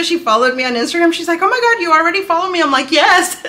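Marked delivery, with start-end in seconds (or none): 1.4-2.6 high, loud, very fast imitation; excited surprise. Designer finding she is already followed, excited surprise. 2.9-3.4 high, loud fan's yes; excited yes. Retold or imitated. Fan confirming she follows the designer, excited. Retold fangirl answering the designer.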